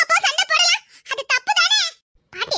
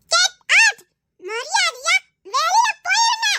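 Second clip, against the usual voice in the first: imitated angry quarrel; 6 semitones higher, slower than usual. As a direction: act out a grown-up ordering someone out, shouting and high. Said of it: "ரொம்ப சண்டை". (சண்டையை நடித்துக் காட்டும் கோபக் கத்தல்)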